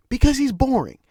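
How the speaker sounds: fed-up emphatic outburst; emphatic repeat. Shown as a demonstration. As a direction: hammer the point home. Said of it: "repeats for emphasis".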